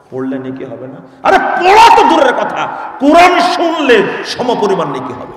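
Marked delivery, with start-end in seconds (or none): 1.2-5.3 fiery preaching. Acted. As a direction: thunder it from the pulpit. Preaching at full force.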